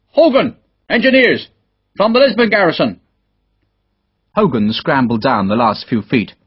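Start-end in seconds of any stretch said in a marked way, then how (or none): 0.1-3.0 high, carrying call down a hillside; shouted introduction. A narrator's reading. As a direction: call out to someone far off. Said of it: "shouted a question down the hillside".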